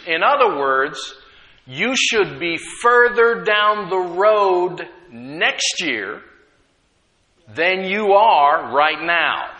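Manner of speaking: rising, forceful sermon cadence; preaching. Acted. Pastor urging the church to grow, preaching with force. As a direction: drive each point home to the congregation. Preacher building the growth theme.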